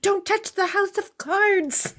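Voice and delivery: high, slow, panicky plea; panicked. Retold or imitated. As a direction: beg in mock panic. Mock panic over fragile plans.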